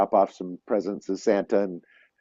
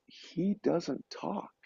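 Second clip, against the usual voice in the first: very soft, slow and high; quoting someone, barely above a whisper, drawn out; hushed confiding; 7 semitones higher, 13 dB quieter, much slower than usual. Retold or imitated. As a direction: retell it as a hushed revelation. Staff's confidence, taken aside, retold.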